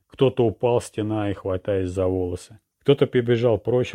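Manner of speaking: plain reading voice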